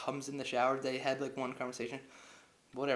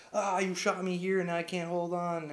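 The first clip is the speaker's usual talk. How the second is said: higher, louder, drawn-out complaint; hammed-up strained whine; mock struggling hero; 5 semitones higher, 5 dB louder than usual. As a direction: act out losing your grip, slowly. Acted strained Bond losing grip.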